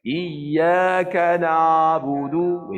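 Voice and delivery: sung ain of na'budu, throat far too open; hammed-up wide-open ain; exaggerated wrong tajwid, over-opened; overopen recitation. Shown as a demonstration. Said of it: "Itu berlampau-lampau bukanya".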